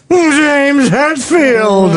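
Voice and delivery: slow, drawn-out, high stadium shout; John Sterling announcer call. Pretend. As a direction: boom the name like a ballpark announcer. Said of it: "John Sterling can announce the concert".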